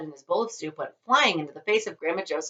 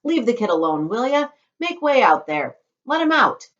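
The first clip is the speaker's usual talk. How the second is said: loud, higher commanding shopkeeper; ordering the crowd; 7 semitones higher, 8 dB louder than usual. Acted. Shopkeeper orders the crowd to let Charlie out, loud. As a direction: clear the way firmly.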